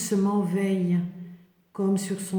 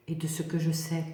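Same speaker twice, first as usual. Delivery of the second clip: whispering; 4 semitones lower, 6 dB quieter, faster than usual. Acted. Whispered close of a sentence.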